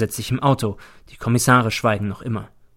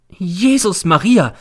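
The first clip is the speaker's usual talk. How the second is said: high, loud, drawn-out exclamation; horrified cry; 8 semitones higher, 6 dB louder, slower than usual. A narrator's reading. Landlady hearing it is the police, horrified cry. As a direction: cry out slowly in horror. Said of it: "schreit die Hausfrau und wird sehr entsetzt".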